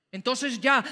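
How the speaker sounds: ordinary talk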